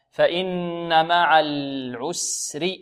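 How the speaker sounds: slow verse with held nun and mim nasal; slow recitation. Shown as a demonstration. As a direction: hold the ghunna slowly. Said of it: "models verse". (ترتيل بطيء مع الغنة)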